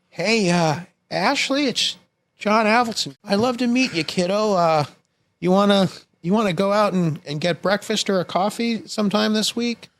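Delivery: John Avildsen voicemail. Retold or imitated. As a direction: play a warm Hollywood dad leaving a message. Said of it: "there's a Beverly Hills message".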